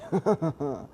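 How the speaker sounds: acted gloating villain chuckle; villain laugh. Sludge villain demanding Deku's body, gloating laugh. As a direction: laugh like a menacing monster.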